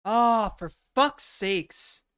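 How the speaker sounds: ordinary delivery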